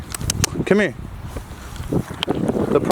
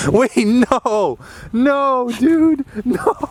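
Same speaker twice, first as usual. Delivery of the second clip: shrieking disbelieving laughter; 13 semitones higher than usual. Real, not acted. Owner watches her dog leap into the lake, shrieking with laughter. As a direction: lose it completely, shrieking with delighted shock.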